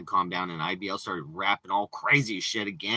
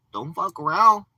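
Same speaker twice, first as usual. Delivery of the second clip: higher, louder tough warning; 9 semitones higher, 8 dB louder than usual. Real, not acted. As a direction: bark a warning. Warning the streets.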